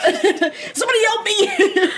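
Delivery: high, laughing call for help; mock cry for help. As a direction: squeal for help through laughter. Mock cry for help while flailing.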